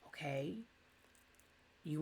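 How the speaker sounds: a whispered, resigned "Okay,". Acted. Husband backing off from his cold wife, whispering. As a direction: breathe "Okay," out in a soft whisper as you give up asking.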